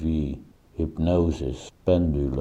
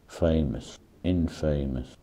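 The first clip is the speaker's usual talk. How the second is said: normal talking voice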